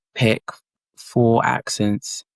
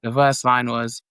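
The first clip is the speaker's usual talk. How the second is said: ordinary delivery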